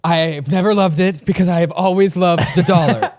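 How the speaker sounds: Donald Trump impression. Co-host asks for a Trump voice, loud hammed-up impression. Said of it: "you should do this in your Donald Trump accent".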